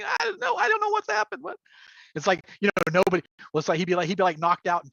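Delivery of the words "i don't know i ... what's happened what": squeaky high playing dumb, through laughter; feigned innocence, high. Shape changer denies everything to players, high innocent whine. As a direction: play innocent, pitched way up. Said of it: "I was like".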